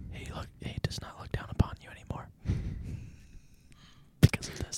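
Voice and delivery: fast hushed priestly whisper. Pretend. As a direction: whisper like a confessor. Mock priest absolving friend.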